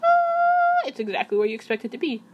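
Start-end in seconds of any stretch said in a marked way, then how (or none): 0.0-0.9 high sung head voice note. Shown as a demonstration. Showing viewers where head voice resonates, high sung ah. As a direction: float a much higher ah into the head. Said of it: "when you sing in your head voice".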